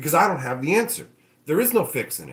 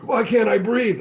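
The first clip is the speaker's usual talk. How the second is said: higher, louder mock panic; mock breathless panic; 6 semitones higher, 4 dB louder than usual. Company choking on its own plastic trees, mock panic. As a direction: act out gasping for air.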